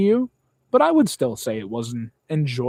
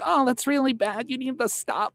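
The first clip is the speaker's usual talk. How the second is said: mocking nagging critic; 10 semitones higher than usual. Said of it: "Some people are like".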